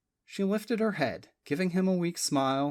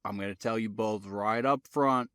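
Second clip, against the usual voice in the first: lower, unhurried Virginia Tidewater drawl; 6 semitones lower than usual. A narrator's reading. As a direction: lay on the Tidewater drawl, matter-of-fact. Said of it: "in his slow Tidewater drawl".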